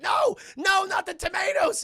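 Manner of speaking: high, loud, hammed-up plea; mock-terrified yell; panicked shout. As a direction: beg loudly as things get thrown. Character pelted with tomatoes.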